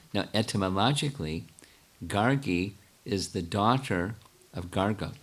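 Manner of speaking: deliberately slow, with long pauses between phrases; measured phrase by phrase, leaving space after each name; slow, careful explanation. Real, not acted. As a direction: say it slowly so they can follow. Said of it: "I'll say it slowly".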